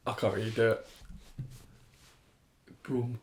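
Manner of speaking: ordinary delivery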